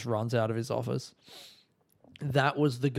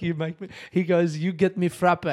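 loud broken English order, higher; imitating Greek agent; 6 semitones higher, 4 dB louder than usual. Agent orders a frappe from the traveller, loud put-on Greek accent.

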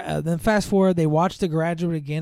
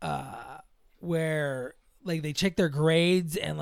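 normal talking voice

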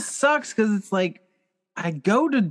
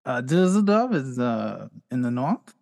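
hesitant put-on German accent; 6 semitones lower than usual. Immigrant answering at his citizenship test, German accent. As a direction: falter through it in a German accent. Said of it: "I can do a German accent".

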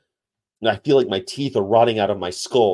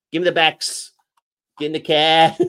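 imitated Chicago accent, building higher and louder; 6 semitones higher, 6 dB louder than usual. Showing how Chicago talks, hammed-up Chicago accent. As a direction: push the Chicago vowels harder each time. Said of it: "That's how they talk in, uh, Chicago".

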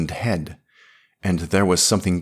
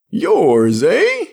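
put-on loud, much higher drunk croak; drunken croak; 14 semitones higher, 8 dB louder than usual. A narrator's reading. Drunk Drylander croaking at Smith over the girl. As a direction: sneer it, drunk and bleary. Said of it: "he croaked".

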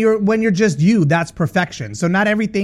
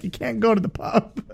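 slow, soft mock-stern mother; a hint of a firm mom saying no; 4 semitones higher, 6 dB quieter than usual. Mother refusing her daughter, lightly voiced stern no. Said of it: "And the mother's like".

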